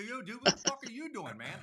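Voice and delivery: high, loud retold comeback; heated quarrel replayed at a shout; angry retort. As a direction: act out an indignant challenge.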